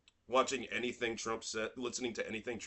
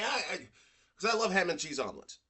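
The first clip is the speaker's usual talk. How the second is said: normal talking voice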